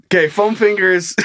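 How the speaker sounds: ordinary talk, nothing marked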